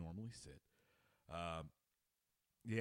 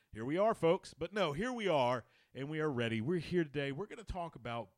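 loud, higher sportscaster opening; sportscaster intro; 5 semitones higher, 8 dB louder than usual. Acted. Host kicking off the sports show, loud announcer. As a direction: open the show like a big broadcast.

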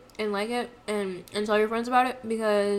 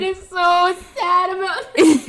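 mock crying; 10 semitones higher, 12 dB louder than usual.

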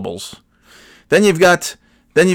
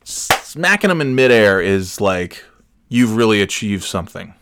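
ordinary talk, nothing marked

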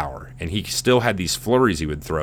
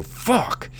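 a sudden pitched-up yelp of a curse; pained curse. Retold or imitated. Fighter hit in the face, curses in a high yelp. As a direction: blurt it like you just took a punch. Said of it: "you could just see him go".

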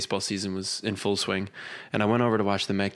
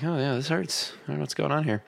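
higher-pitched puzzled complaint; mild retold bewilderment; 6 semitones higher than usual. Rubbing his eyes after petting the cat, mock bewilderment. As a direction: replay dawning confusion, lightly hammed up. Acts self with itchy eyes.

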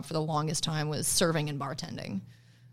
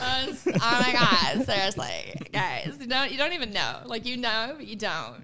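exaggerated California girl, higher pitch; 4 semitones higher, 3 dB louder than usual. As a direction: gush like a California valley girl. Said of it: "It's the California coming out of me".

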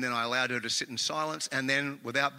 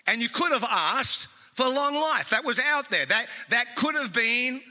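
louder, higher, driving pulpit delivery; preaching; 6 semitones higher, 4 dB louder than usual. Acted. Pastor preaching to the congregation, full-force sermon delivery.

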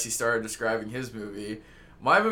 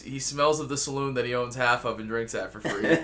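ordinary talk, nothing marked

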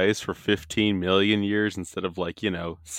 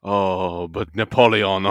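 loud, hammed-up French boast; a loud mock French protest; 7 dB louder, much slower than usual. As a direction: boast about Napoleon like an offended Frenchman. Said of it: "someone French will talk about".

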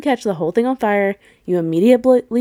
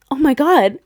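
mock panic; 5 semitones higher, 5 dB louder than usual. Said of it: "And she's like".

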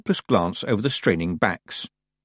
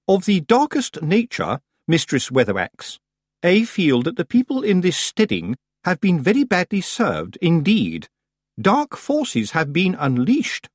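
high, affected, portentous; pompous society witch; 9 semitones higher than usual. A narrator's reading. Missus Earwig tells Granny of dark forces, pompous. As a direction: grandly lament in a high voice.

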